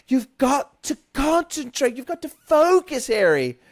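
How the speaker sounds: very high, loud whiny Hermione; whiny Jim Dale Hermione. Retold or imitated. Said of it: "Jim Dale's like".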